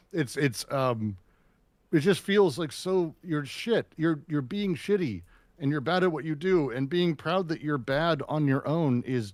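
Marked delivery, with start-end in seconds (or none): none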